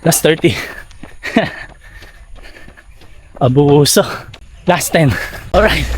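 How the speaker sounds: rising excited countdown mid-sprint; while running. Real, not acted. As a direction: call out the distance while sprinting. Counting down final meters.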